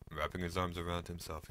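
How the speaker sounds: plain reading voice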